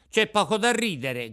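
loud and high, piqued; offended shout. Acted. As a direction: snap back, stung by the laughter. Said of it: "gridò Pinocchio impermalito".

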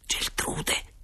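secretive, sibilant whisper; hissed whisper. A narrator's reading. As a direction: hiss the name under your breath. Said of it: "sibilò il mio compagno". (sibilo sussurrato)

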